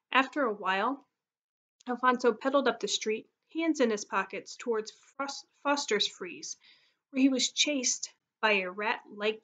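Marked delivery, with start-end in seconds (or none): none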